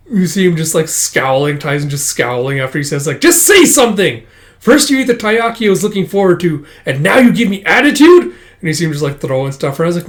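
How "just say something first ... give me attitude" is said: high, loud indignant demand; mock angry outburst. At scowling Taizen after the taiyaki, mock outburst. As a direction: blow up at someone, acted out. Quarrel over eaten taiyaki.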